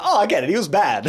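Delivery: mock realisation. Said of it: "'Cause he was like".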